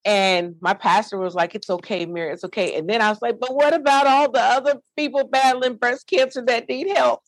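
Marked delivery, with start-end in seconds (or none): 1.5-2.8 soft pastor's reassurance; hushed, consoling quote; soothing. Retold or imitated. Pastor comforts her as she cries. 3.4-7.2 high-pitched imitated crying plea; re-enacted distraught appeal, pitched up; tearful plea. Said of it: "how you stop crying all of a sudden".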